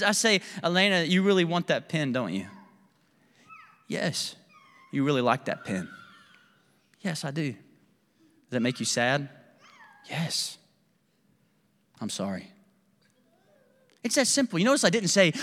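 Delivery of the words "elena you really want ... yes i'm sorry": put-on softer, gentle father to child; a quiet, caring dad validating his sad daughter; tender comfort. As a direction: soothe her softly.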